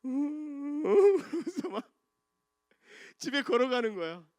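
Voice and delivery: hammed-up sobbing walk home; mock crying. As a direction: wail it like a soaked little kid. Child walking home crying.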